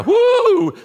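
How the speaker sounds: high, loud comic "whoo"; mock thrilled whoop. Preacher mocking waiting for a feeling to give, exaggerated whoop. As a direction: whoop as if a sudden shiver hit you. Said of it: "waiting for a quiver in my liver".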